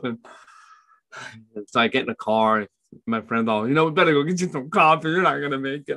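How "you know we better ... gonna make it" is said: a lightly voiced friend, higher and quicker; teasing friend. Friend in the car about coffee, a lightly voiced teasing tone.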